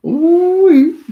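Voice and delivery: a big high-pitched burst of laughter, loud. Real, not acted. Laughing out loud alone on the stream at something. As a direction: let a real laugh explode out high and loud.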